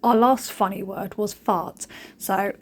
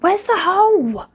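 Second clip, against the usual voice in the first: frustrated squeak; 10 semitones higher, 7 dB louder, slower than usual. Retold or imitated. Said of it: "I was like".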